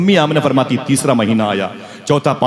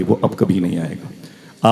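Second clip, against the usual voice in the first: grieving news; 4 semitones lower, 5 dB quieter, slower than usual. Acted. Grandfather breaks death news.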